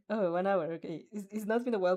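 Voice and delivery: usual speaking voice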